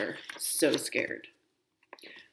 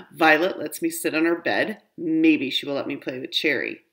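usual speaking voice

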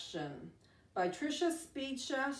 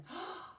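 mock gasp; 7 semitones higher, 8 dB quieter than usual. Storyteller pretends to spot kids.

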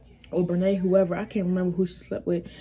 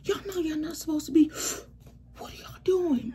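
higher-pitched imitation of a brother's scolding; mock fussing out; 8 semitones higher than usual. Brother fussing out the misbehaving pair, mock scolding. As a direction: fuss at them in a raised pitch, retelling it. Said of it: "My brother trying to fuss us out".

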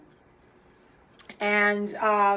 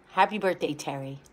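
usual speaking voice

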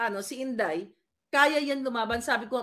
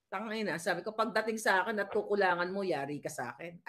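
low, soft inner threat; muttered to herself; 6 semitones lower, 5 dB quieter than usual. Retold or imitated. Quietly threatening the cashier in her head. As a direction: mutter a threat under your breath. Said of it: "Sabi ko sa sarili ko".

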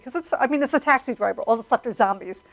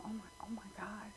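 imitating a moved viewer, whispered; quick hushed whisper, as if overwhelmed; awed whisper; 27 dB quieter, faster than usual. Imitating moved audience members.

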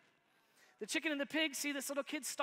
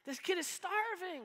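indignant chicken; 7 semitones higher, slower than usual. Retold or imitated. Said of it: "And the chicken's like".

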